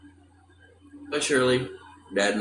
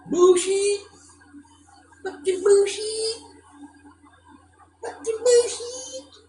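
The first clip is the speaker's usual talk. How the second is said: very high, loud outraged yelling; 4 dB louder, slower than usual. Real, not acted. Streamer yelling at chat that it's bullshit, outraged. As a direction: yell it out, fed up and furious. Angry outburst, streamer protesting.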